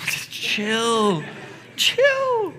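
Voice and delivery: very high, drawn-out falsetto; comic calming. Acted. Jesus telling stressed Martha to relax, comic falsetto. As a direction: squeak out a comic plea to relax. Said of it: "chill her out".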